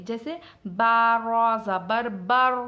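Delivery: slow, loud full बर; heavy bar. Shown as a demonstration. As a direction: spell out बा रॉ ज़बर बर with a thick रॉ. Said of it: "रा की आवाज़ पुर हो जाएगी". (मोटी आवाज़ में बर)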